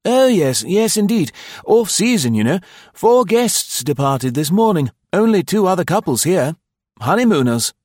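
high, loud, cheerful innkeeper; eager host. A narrator's reading. Host assures arriving guest there is a vacancy, eagerly.

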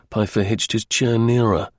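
usual speaking voice

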